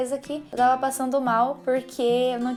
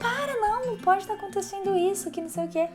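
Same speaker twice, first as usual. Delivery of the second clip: much higher, mock panicked protest; retold panic; 10 semitones higher than usual. She refuses the news in the pharmacy, mock panic. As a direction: squeal a pleading no, half-playing the panic. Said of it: "E eu".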